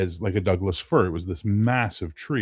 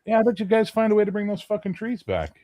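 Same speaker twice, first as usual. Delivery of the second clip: sarcastic mock; 12 semitones higher, faster than usual. Sarcastic retort to colonists.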